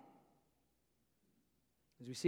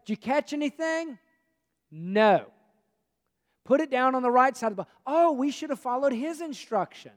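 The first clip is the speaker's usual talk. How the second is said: acted fishermen; 8 semitones higher, 6 dB louder than usual.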